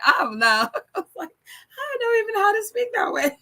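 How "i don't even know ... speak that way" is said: high voice, laughing through the words; amused, high-pitched self-mockery; laughing mock dismay. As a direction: squeal it helplessly through laughter. Laughing at her own retold panic.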